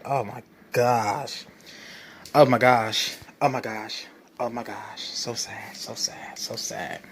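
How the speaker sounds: mock "oh my gosh", sinking into a near whisper; mock sorrow fading. Fading sarcastic mourning.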